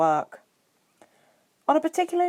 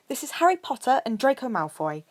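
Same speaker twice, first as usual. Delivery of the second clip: higher, bright introduction; cheerful; 7 semitones higher than usual. A narrator's reading. Hermione introducing her friends to Hagrid, cheerful. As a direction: introduce them with a smile. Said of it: "Hermione said cheerfully".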